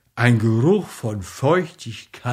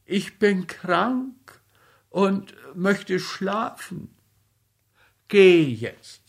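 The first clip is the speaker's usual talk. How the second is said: higher, slow whining plea; whiny voice; 5 semitones higher than usual. A narrator's reading. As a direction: plead like a sulky child. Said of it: "sprach mit weinerlicher Stimme". (weinerliche Stimme)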